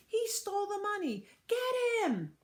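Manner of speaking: high, loud yell. Acted. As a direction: yell it to rally the crowd. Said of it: "somebody yelled".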